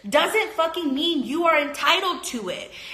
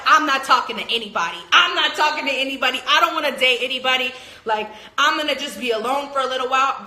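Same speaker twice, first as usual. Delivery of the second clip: rapid declaration; faster than usual. Retold or imitated. Vlogger voices a Pisces swearing off dating, fast and insistent. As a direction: rattle it off as the Pisces shuts everyone out. Voicing Pisces pushing people away.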